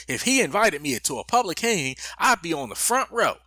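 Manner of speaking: mock Southern belle drawl, stretched vowels. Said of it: "drawl and everything".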